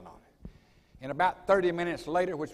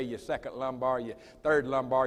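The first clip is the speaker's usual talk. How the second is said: normal talking voice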